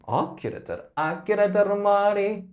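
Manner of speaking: loud, exasperated spoken line. Shown as a demonstration. Coach demos the exasperated speaking section. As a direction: sound fed up with everyone. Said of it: "It's like exasperated".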